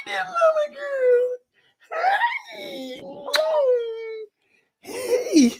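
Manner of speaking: high-pitched cooing. Real, not acted. Greeting baby daughter on stream.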